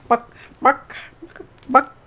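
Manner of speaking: imitated hen clucks; chicken clucking. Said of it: "it would be like".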